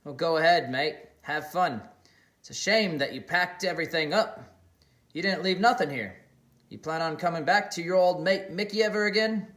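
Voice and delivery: guilt-trip him with a hurt grin; mate feeling betrayed, half joking; needling Mickey. Acted. Mickey confronts Xander.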